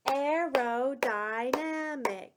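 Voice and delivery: slow, syllable by syllable; clapped syllables. Shown as a demonstration. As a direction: clap out each beat of Aerodynamic. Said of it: "Let's clap it".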